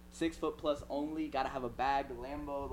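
mock dating-bio readout. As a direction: reel it off quietly like a profile. Reciting an imagined Tinder bio.

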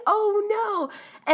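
high, whimpering "oh no"; near tears. Retold or imitated. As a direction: squeak it out like you're about to cry. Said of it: "I wanna cry".

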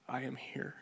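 soft unvoiced whisper of a presence; whispered divine voice. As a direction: breathe it like a voice out of the dark. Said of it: "I heard the audible voice say".

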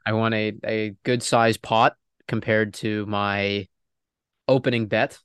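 normal talking voice

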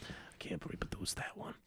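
hushed whisper. Real, not acted. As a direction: breathe it out, no voice. Says line twice, second whispered.

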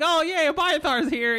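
put-on high, cheering kid voice; excited kids. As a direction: greet him with high delight. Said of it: "They're like".

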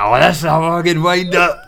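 high, loud mock indignation; pranked dad impression. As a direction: protest like a dad who's been had. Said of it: "[name] fucking voices".

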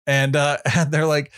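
usual speaking voice

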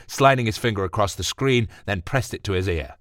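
usual speaking voice

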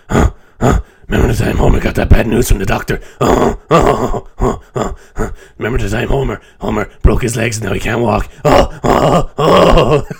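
raspy mocking sister cackling about Homer; Patty impression. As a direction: gloat over Homer's misfortunes, then the oh oh laugh. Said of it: "impression of Patty and Selma".

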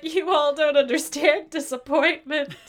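hammed-up weepy declaration; mock tearful adult. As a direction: overdramatic grown-up lament. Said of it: "I'd just be like".

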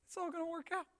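soft, high soothing imitation; mock-calm comforter, unruffled; easygoing reassurance. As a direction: gently talk someone down. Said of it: "he's like".

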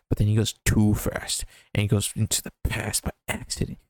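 ordinary talk

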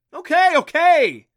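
high, loud, drawn-out yell; slow, high-pitched holler; startled yell. A narrator's reading. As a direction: yell it while backing off in alarm. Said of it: "he yelled".

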